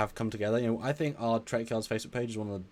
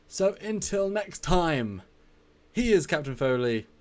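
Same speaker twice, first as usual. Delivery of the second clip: sign-off announcement; 7 semitones higher, 5 dB louder than usual. Acted. Signing off the stream.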